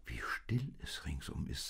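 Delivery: soft, near-whispered remark; quiet, half-whispered awe; hushed wonder. A narrator's reading. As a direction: speak softly into the stillness. Maria marvels at the quiet night.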